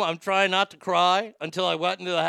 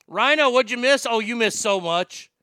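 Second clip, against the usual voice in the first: calling out; 8 semitones higher, 9 dB louder than usual. Real, not acted. Calling to a friend arriving.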